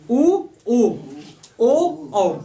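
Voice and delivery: उ, उ, ओ, औ one at a time, lips in a circle; rounded-lip vowels. Shown as a demonstration. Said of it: "जो ओंठ होंगे वो गोल होंगे". (उ, उ, ओ, औ हर स्वर गोल होंठों से)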